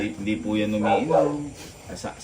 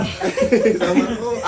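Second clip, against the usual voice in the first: high laugh bursting out; laughter; 9 semitones higher than usual. Real, not acted. Laughs during the goodbye. (mataas na halakhak)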